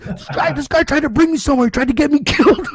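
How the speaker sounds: high, fast, mock-panicked complaint; hammed-up terrified friend recounting the ordeal; frantic retelling. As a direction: spill it out breathless with alarm. [name] retells scare, frantic.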